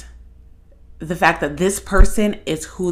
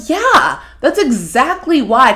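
excited agreement; 6 semitones higher, 4 dB louder than usual. Retold or imitated. Excited reaction to the video.

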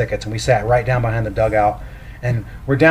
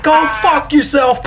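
mock shout, much higher; 14 semitones higher than usual. Dad in front of the kids yelling at Pags, a hammed-up shout. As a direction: yell it across the dugout. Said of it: "Just shouts it".

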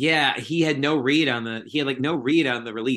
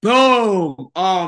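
excited shout; 7 semitones higher, 9 dB louder than usual. Real, not acted. Goal scored while watching game.

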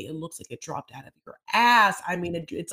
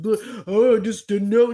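mock Sean; 2 semitones higher, 9 dB louder than usual. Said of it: "He's like".

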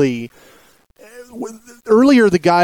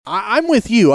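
ordinary talk

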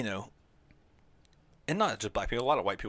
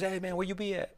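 "hey man, where you be at" with a higher casual voice; imitating slang; 7 semitones higher than usual. Someone greeting a friend, lightly mimicking slang. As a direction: mimic a laid-back greeting. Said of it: "If someone says".

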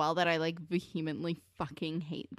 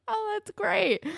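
relieved; 13 semitones higher, 4 dB louder than usual. Retold or imitated. Relieved friends.

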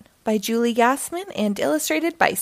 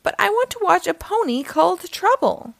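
high, whiny little sister voice; whining; 9 semitones higher than usual. Acted. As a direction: whine it like a spoiled little girl. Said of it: "his sister stomped her foot and whined".

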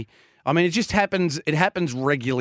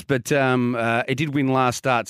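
ordinary talk, nothing marked

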